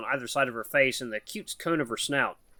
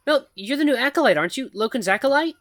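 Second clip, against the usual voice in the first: higher, halting nervous voice of a shy mouse; timid mouse woman; 12 semitones higher, 6 dB louder than usual. Acted. Shy mouse woman nervously calls to the human, halting and high. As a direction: stammer it out like a timid mouse calling for attention. Mouse asks about acolyte.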